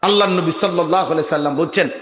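ordinary talk

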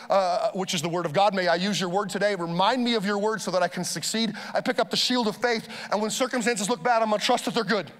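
rattled-off prayer, quick and practiced; a pastor speeding through his morning armor prayer; fast prayer. Shown as a demonstration. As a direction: pray it fast like a daily habit. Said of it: "See how fast I just prayed that?".

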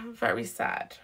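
breathy whisper, low and soft. Real, not acted. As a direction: drop to a whisper on "Very sad". Tarot reader repeats sad reading.

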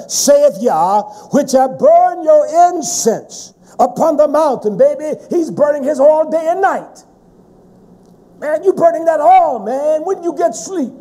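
impassioned, high-pitched sermon cadence; forceful preaching. Acted. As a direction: drive the warning home to the congregation.

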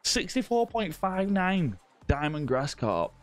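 high and slow, savoring each number; excited announcement. Real, not acted. Streamer hyping a viewer's sixty-four pound carp, excited and slow. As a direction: hype up the giant catch, drawn out.

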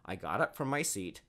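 ordinary delivery, nothing marked

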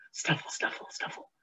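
soft breathy snuffling; hedgehog snuffle. A narrator's reading. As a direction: sniff it out like a little hedgehog. Hedgehog sniffing in story.

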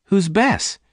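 high-pitched sharp query; quick, startled question. A narrator's reading. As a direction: demand to know in Jane's high voice. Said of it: "Jane asked quickly".

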